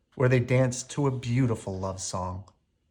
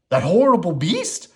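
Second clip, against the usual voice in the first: loud high astonished question; amazement; 7 semitones higher, 8 dB louder than usual. A narrator's reading. Maurice amazed the beast let Belle go. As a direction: disbelief in every word. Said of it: "he asked her in amazement".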